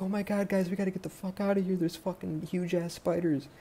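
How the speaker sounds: high, frantic warning, rushed then dragged out; panicked. Retold or imitated. Boyfriend runs up to the gang warning them, high frantic panic. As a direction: blurt it out in a terrified, breathless rush.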